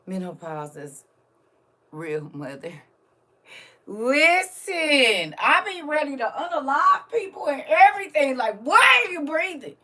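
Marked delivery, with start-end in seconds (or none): 3.9-8.4 loud, high-pitched exasperated rant; worked-up comic exasperation; fed-up venting. Real, not acted. Venting about irritability. 8.6-9.8 high, loud mock outrage; hammed-up exasperated yell; irritated outburst. Acting out irritation at people.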